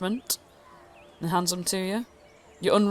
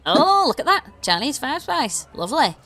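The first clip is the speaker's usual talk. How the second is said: much higher, louder goblin delight; 8 semitones higher, 5 dB louder than usual. Acted. Goblin thrilled at the Chinese five spice, high and loud. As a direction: coo over it like a greedy goblin.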